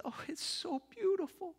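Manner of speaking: high, hushed wonder; overwhelmed awe. Acted. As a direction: breathe it out in amazement. Agnes overwhelmed by the cake.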